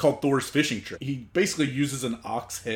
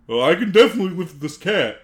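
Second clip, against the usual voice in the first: loud, higher oafish boast; dumb Thor; 5 semitones higher, 7 dB louder, faster than usual. Acted. Thor taking Loki's bet on the cat, dim-witted boasting. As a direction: play a big dumb lug, overconfident. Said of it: "being the big dumb fucking idiot".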